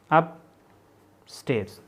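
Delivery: "Upstairs" very slow, long pause after अप; broken into parts. Shown as a demonstration. Teacher spelling out strokes for students, "upstairs" slowly in two parts. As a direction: split "upstairs" into अप and stairs. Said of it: "ठीक है".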